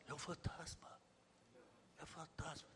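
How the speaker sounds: "fantasma" said very softly and flat, no fear at all; flat mumble. Shown as a demonstration. Preacher showing how the disciples did not say it, softly and flat. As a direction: mumble it as if it were nothing. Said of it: "Não falaram assim".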